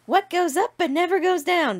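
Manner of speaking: a hint of a sing-song Sarah. Reading a meme as Sarah, lightly voiced. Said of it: "I'm gonna read it in their voices".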